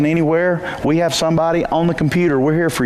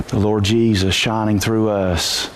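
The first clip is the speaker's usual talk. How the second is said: usual speaking voice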